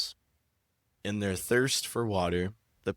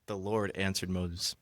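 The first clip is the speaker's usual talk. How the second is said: ordinary talk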